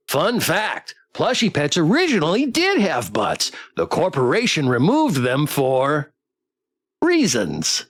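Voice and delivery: sing-song robot pet with swooping pitch; chirpy, then dropping on the last word; perky plush companion trivia; fun-fact toy pet. Acted.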